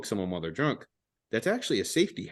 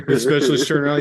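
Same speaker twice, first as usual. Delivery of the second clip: ordinary delivery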